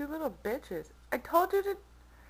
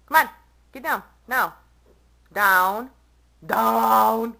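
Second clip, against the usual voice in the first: loud firm command at the cat; yelling; 11 dB louder, slower than usual. Real, not acted. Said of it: "you just get to hear me yell".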